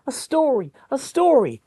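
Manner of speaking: put-on high, loud childish chant; bunnies begging loudly. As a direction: clamour like excited little bunnies. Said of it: "the young bunnies roar".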